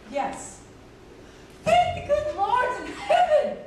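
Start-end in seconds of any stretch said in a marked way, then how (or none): 1.6-3.6 loud, high burst of thankful joy; overjoyed relief. Acted. Producer hearing there will be a wedding, jubilant loud cry. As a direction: shout your thanks to heaven, laughing with relief.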